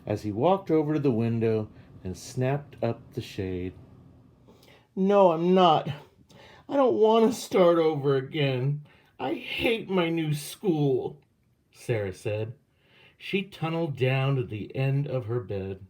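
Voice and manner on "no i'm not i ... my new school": higher, louder whining protest; upset protest. A narrator's reading. Girl protesting to her father about new school, loud whine. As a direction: push back hard against dad.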